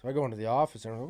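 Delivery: ordinary talk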